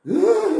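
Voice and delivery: high, sudden "uh"; put-on thrill squeal; mock stomach-drop whoop. Shown as a demonstration. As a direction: whoop as if on a roller coaster. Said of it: "aquele frio na barriga".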